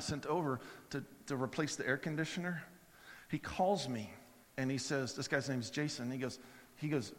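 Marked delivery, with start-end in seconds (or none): none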